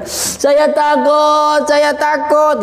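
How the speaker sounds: high, drawn-out mock crying; acted fearful wail. Frightened soul at the bridge, acted whining plea. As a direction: wail it slowly as if terrified. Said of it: "itu ada yang begitu nanti".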